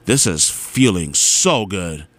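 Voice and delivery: low, slow, savouring groove; blissful relief. Retold or imitated. Passenger enjoying the car's A C, blissful. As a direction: sink into it, low and drawn out. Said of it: "I was like".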